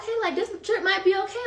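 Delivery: high-pitched, hopeful re-enacted thought; a little excited. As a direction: think aloud with budding excitement, pitched high. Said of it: "now I'm getting a little excited".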